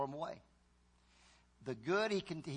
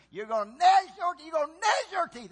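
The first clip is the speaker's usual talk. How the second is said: loud, high preacher's scream; shouting warning at the congregation; screaming evangelist; 10 semitones higher, 9 dB louder than usual. Retold or imitated. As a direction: yell it like a hellfire evangelist. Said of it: "He was screaming".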